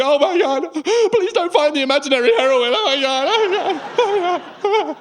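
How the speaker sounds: high, loud panicked pleading; mock-hysterical, high whimpering dread; hammed-up terror. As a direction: beg in comic terror as you are searched. Acted terror while being scanned.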